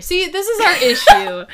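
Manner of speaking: laughing. Real, not acted. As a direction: say it while cracking up. Laughing at themselves.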